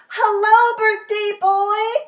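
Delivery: big booming hello, drawn out; bellowing. A narrator's reading. Ted bellowing hello to the birthday boy, loud. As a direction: bellow a greeting across the kitchen. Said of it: "Ted bellowed".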